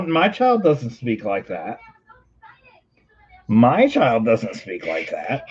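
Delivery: slow, deliberate disbelief; measured parental protest; bewildered parent. Real, not acted. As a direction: say it slowly, refusing to accept it. Parent mock-disowning creepy child.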